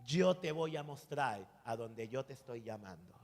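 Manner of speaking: low, softened voice of God; God's inner voice. Retold or imitated. God answers the pastor's question in his heart, low and soft. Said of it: "Dios me dijo en mi corazón".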